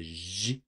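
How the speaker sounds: j said as a French zhee; French j, zhee with no d before it; French j sound. Shown as a demonstration. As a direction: buzz the j softly as zhee. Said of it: "sounding at the end".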